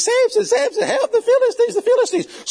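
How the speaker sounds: high loud squeal, laughing through it; mock alarm in a high voice. Delilah wakes Samson with fake alarm, a high loud mock shriek.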